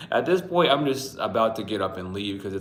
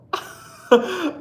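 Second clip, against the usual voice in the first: big high laugh; 8 dB louder than usual. Real, not acted. Laughing after waking story.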